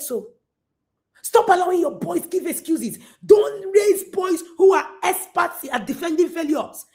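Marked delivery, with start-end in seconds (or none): 1.2-6.8 climactic exhortation. Real, not acted. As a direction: build to the peak.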